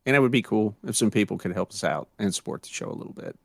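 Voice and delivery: usual speaking voice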